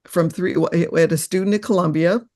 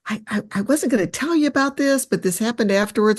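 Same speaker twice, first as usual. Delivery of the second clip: niece's hesitant words; 6 semitones higher than usual. Retold or imitated. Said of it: "And she just said".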